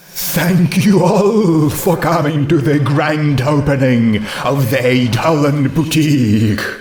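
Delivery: slow, happy ghost announcement; a hint of a hollow ghostly host. Ghost mare welcoming the crowd, slow and happy.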